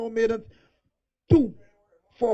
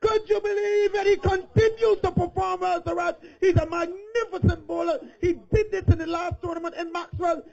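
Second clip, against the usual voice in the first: higher, lively play-by-play call; excited commentary; 6 semitones higher than usual. Acted. Commentator thrilled by the bowler's spell, excited call. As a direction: call the wicket with rising excitement.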